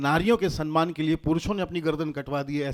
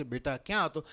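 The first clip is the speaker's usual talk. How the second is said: softly spoken saintly elder; gentle holy man; 8 dB quieter than usual. Acted. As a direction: ask it softly, like a kindly monk. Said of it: "આવા સાધુ સંત મહાત્મા પૂછે".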